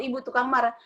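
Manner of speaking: usual speaking voice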